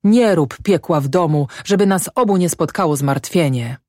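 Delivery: ordinary delivery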